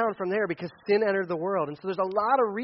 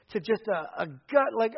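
normal talking voice

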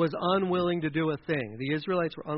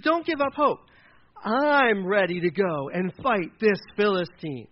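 fervent and drawn out; impassioned, eager; 4 dB louder, slower than usual. Acted. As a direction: act a young champion fired up for battle. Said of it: "David's got passion. He's got excitement".

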